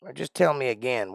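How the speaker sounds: ordinary talk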